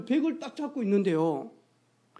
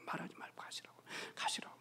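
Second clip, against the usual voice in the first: urgent soft whisper; whispered urging; 23 dB quieter, faster than usual. Retold or imitated. Preacher whispers to the driver to just leave, urgent whisper. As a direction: whisper him away, urgently.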